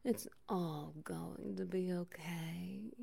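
breathy whisper, rising at the end; whispered reassurance. Acted. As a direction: whisper it close and gentle. Comforting, repeated line.

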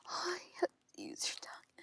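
fast pleading whisper with a cry; urgent whisper. Acted. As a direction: beg in a quick whisper.

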